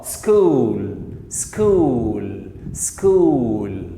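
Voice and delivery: "سكول" very slow, long oo after sk. Shown as a demonstration. Teacher drilling C after S as K, slow stretched word. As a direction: stretch "سكول" as s-kooool each time. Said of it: "تنطق ك".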